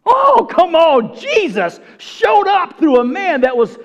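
high, loud cry to the congregation; fervent preaching. Real, not acted. Preacher rousing the church over Jesus showing up, fervent cry. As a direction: burst out with preacher's fervour.

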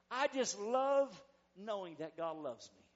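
ordinary talk

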